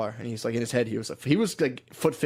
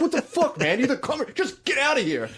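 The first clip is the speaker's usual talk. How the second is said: imitating an enraged boss, way higher in pitch; furious boss; 13 semitones higher than usual. Boss yelling at the quitting trainer, imitated high furious rant. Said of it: "He was furious".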